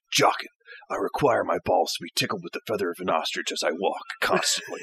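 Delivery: low, quiet, deadpan lordly order; mock-solemn master giving an absurd order; retold master's command. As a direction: speak low and soft, utterly serious. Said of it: "he always used to go".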